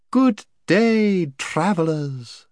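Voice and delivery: high, sweet, trilling lady; sweet birdsong voice. Acted. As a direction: trill the R's, sweet as a bird. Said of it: "in a voice as sweet as the sweetest bird song, trilling her R's delightfully".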